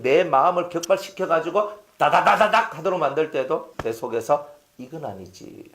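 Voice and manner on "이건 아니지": very soft aside to oneself; inner restraint. Acted. Pastor acts talking himself out of retaliating, hushed. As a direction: check yourself under your breath.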